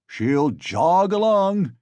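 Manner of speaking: slow, high teasing drawl; mock the poor nag; mocking jeer. A narrator's reading. Crowd mocks the mare.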